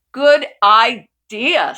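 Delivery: loud, slow and pleased, a hearty uncle; booming uncle. Acted. Uncle booming his approval to the family. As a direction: draw out a big approving "Good idea".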